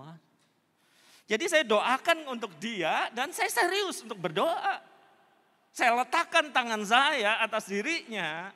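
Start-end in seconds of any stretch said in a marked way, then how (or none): 1.3-8.5 loud, high-pitched preacher delivery; forceful preaching. Real, not acted. Preacher tells congregation how he prayed for her, forceful preaching.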